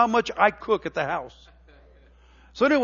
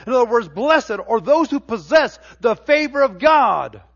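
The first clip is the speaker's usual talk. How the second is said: louder, rising preacher's cadence; fervent preaching; 4 semitones higher, 5 dB louder than usual. Acted. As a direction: drive each line home to the church. Preaching builds to full force.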